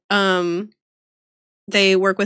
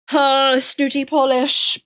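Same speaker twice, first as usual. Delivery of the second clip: snooty Polish; 3 semitones higher, 3 dB louder, slower than usual. Acted. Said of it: "I missed an accent reading".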